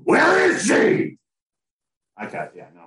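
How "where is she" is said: high, loud, slow Batman shout. Pretend. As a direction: bellow it like a hammy Batman. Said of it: "my Batman voice is definitely better".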